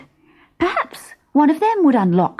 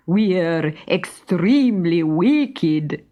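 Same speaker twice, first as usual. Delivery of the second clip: very slow, relishing bandit voice; a slow, gleeful villain's claim; proud bandit; much slower than usual. Acted. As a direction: savour the word wicked. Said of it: "said Victor".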